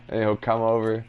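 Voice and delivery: ordinary talk, nothing marked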